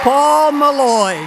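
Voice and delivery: slow, loud called name; calling out. Real, not acted. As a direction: call the name to the room. Calling name again for applause.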